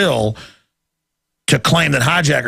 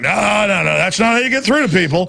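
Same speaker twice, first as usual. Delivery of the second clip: a hint of a prissy scolding voice, pitched up; scolding the barking dog, lightly mocked; mocking the critics; 7 semitones higher than usual. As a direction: lightly sneer as the hand-wringing critics. Mocking critics telling him calm down.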